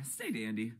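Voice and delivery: ordinary talk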